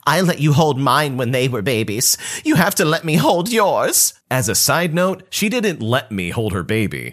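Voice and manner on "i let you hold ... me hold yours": whiny entitled cousin. Retold or imitated. Cousin argues she deserves turn.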